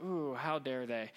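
low, soft, slow mock offence; mock indignation. Preacher mimics someone offended on Facebook, low mock indignation. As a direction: drag it out low, playing quietly scandalized.

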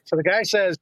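ordinary delivery, nothing marked

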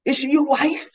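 demanding. Acted. Swimmer confronting the old man, loud demanding. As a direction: press him hard for an answer. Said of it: "you demanded".

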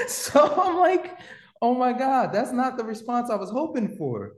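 high, laughing and half crying; words squeezed out through laughter. Real, not acted. Laughing hard as he tells the host the story. Laughing retelling awkward moment.

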